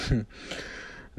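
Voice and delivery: a quiet, airy little laugh; soft breathy giggle. Acted. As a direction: giggle gently at his mom. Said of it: "Chase giggles at his mom's".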